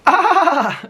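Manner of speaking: high, loud mock cackle; imitated laugh outburst. Imitating Alex laughing at his show, loud cackle. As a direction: burst out in an exaggerated high laugh. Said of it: "like, every two minutes".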